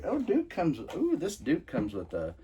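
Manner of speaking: high-pitched surprised discovery; pleasant surprise. Real, not acted. Collector spots an unexpected stand with his figure, pleasantly surprised. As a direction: pitch leaps up at an unexpected find.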